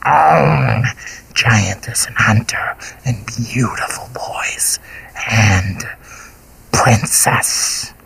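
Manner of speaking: slow, hungry creature whisper; monster whisper. A narrator's reading. Golgoroth crooning to the princess in a slow creature whisper. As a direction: whisper like a beast savoring prey.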